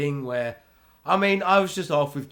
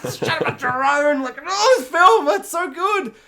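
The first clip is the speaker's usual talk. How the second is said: much higher, louder gushing squeal; ecstatic squeal; 13 semitones higher, 8 dB louder than usual. Retold or imitated. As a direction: gush over the drone shot. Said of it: "I'm like".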